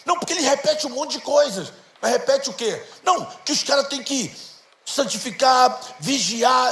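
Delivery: a hint of the eager son; child's voice. (um leve tom de criança explicando)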